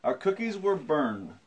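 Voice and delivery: storytelling. A narrator's reading. Reads picture book aloud.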